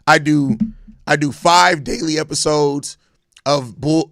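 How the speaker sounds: ordinary talk, nothing marked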